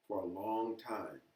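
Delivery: plain reading voice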